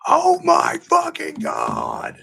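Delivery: high, slow drawn-out yell; loud shout. Retold or imitated. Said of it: "being loud as fuck".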